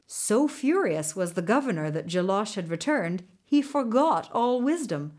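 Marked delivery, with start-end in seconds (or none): none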